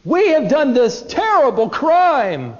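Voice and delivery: grieving brothers owning their crime, slowly; high, slow lament of guilt; anguished confession. Retold or imitated. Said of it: "as if they smite their breasts and they say".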